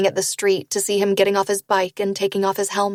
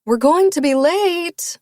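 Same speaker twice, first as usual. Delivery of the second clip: higher, louder, slow call upstairs; yelling up the stairs; 9 semitones higher, 4 dB louder, slower than usual. A narrator's reading. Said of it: "my mother yells up the stairs".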